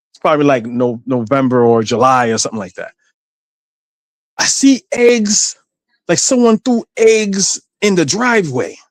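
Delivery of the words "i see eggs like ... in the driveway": suspicious mother. Retold or imitated. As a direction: press him like a doubtful mother. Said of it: "she was like".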